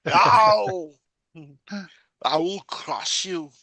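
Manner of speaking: slow, menacing barbarian warrior voice. Pretend. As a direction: bellow it like a hammy barbarian. Playing a barbarian after movie line.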